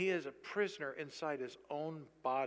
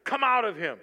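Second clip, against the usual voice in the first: loud, raised stern command; 4 semitones higher, 10 dB louder than usual. Acted. Preacher voicing Jesus casting out a demon, stern. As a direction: order it out with force. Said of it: "He said it sternly".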